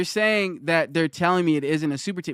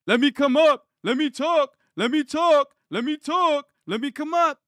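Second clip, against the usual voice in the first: high mocking beggar's plea; whiny begging kid; 8 semitones higher than usual. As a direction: mimic a kid nagging to come up.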